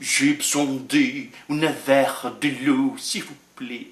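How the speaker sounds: slow French request, dropping very soft at the end; French phrase again. Shown as a demonstration. Preacher repeats the French line, slow French. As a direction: say it slowly in French, let it die away. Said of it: "nenhum brasileiro vai falar".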